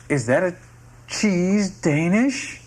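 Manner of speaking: slow, high, rapturous question. Acted. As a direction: marvel over the discovery. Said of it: "gleams with rapturous recognition".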